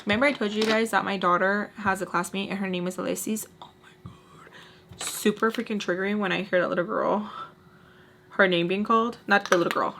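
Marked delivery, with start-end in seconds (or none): 3.6-5.0 whispered "oh my God" in exasperation; whispered dismay. Acted. Vlogger reacting to her daughter's classmate's name, whispered dismay. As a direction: breathe it out in a slow, voiceless whisper.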